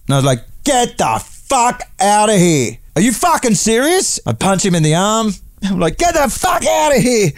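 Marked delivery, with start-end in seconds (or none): 0.6-4.2 high, slow, stretched-out outrage of delight; overjoyed, drawn-out get out of here; excited disbelief. Retold or imitated. Excited disbelief retold to passenger. 6.0-7.3 very high excited shout; delighted shout. Retold or imitated. Punches him, shouting in delight.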